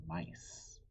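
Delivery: usual speaking voice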